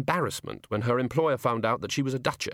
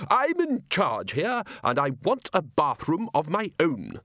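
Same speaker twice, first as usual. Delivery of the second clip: higher, slow and firm; 5 semitones higher than usual. Acted. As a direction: put your foot down. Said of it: "said Ridcully firmly".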